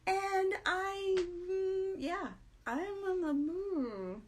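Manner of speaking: forced cheer while exhausted; mock perky. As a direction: pretend to be bright and chipper.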